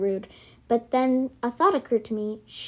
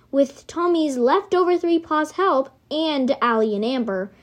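usual speaking voice

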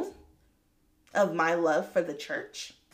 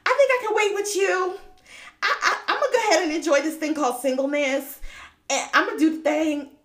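much higher and louder, giddy and resolved; bubbly high voice of newfound independence; excited resolve; 8 semitones higher, 6 dB louder than usual. Retold or imitated. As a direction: act out her eager decision. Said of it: "'Cause I was like".

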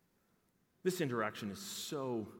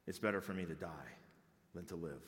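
a hint of a weary, hopeless Jonah, said softer; understated despair; 7 semitones lower, 6 dB quieter, faster than usual. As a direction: let the wish to die sink in quietly. Jonah's despairing wish to die.

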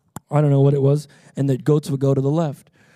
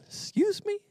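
squeaky, high, softer disbelief; mock offense; 4 dB quieter than usual. Traveler reacting to being called sheeple, squeaky mock offense. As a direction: ask it very high, as if affronted.

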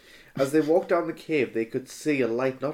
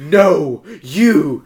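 loud laughing mock shout; 4 semitones higher, 12 dB louder than usual. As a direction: yell it back as Snape. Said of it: "shouted Snape".

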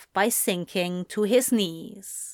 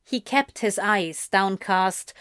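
ordinary delivery

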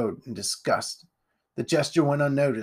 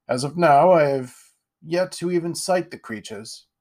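higher, grand aristocrat voice; pompous lord; 4 semitones higher, 4 dB louder than usual. Acted. Lord Ironwood greeting Jack, pompous and grand. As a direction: puff yourself up like a self-satisfied lord.

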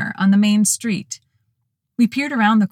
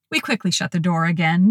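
usual speaking voice

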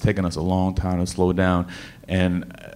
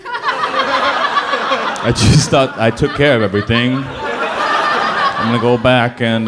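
laughing; 4 semitones higher, slower than usual. Real, not acted. Laughing at his own story.

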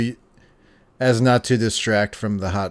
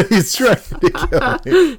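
high, slow echo of a panicked quote; amused, high-pitched imitation; laughing mimicry; 12 semitones higher, slower than usual. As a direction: repeat the mock cry through laughter. Echoes the quote through laughter.

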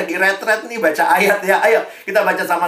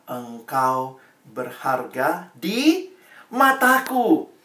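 very slow, weighty, each word laid down; slow emphatic verse; slow solemn reading; 4 semitones lower, much slower than usual. Shown as a demonstration. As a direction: read it like God's own promise. Reading God's words to youths.

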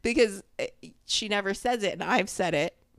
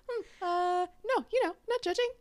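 much higher, prim boss voice; imitating her boss; 10 semitones higher than usual. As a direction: mimic the boss pretending not to judge. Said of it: "she's talking like this".

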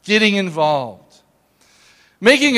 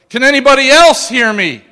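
louder, higher retold yell for help; 5 semitones higher, 9 dB louder than usual. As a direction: call out loudly to anyone in earshot. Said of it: "and then he yells out".

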